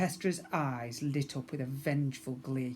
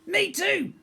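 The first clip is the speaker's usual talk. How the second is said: very high, louder quick voice; fleeing guard; 7 dB louder than usual. A narrator's reading. Second guard fleeing the hall, high voice. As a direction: squeak it running off.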